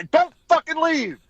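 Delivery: high, loud and slow barked order; mock yell. Star barking at the comedian not to leave, mock yell. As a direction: snap it out, don't you dare leave. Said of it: "we're in the trainer's room".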